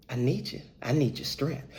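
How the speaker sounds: low, soft pleading; hushed plea. Retold or imitated. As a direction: drop low and soft to beg for help. Repeats the plea to agent.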